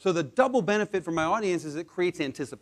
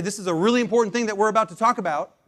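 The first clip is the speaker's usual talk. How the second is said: quick and a touch louder; fast; 4 semitones higher, 5 dB louder, faster than usual. Shown as a demonstration. Demonstrating fast talk before a pause, quick and louder. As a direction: rush the build-up. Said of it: "Slam on the brakes".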